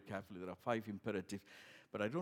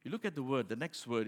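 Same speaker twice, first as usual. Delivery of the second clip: usual speaking voice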